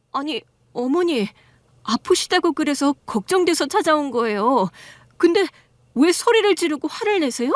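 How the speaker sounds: plead, bewildered and upset; lightly voiced daughter-in-law protesting to her mother-in-law; hurt daughter-in-law.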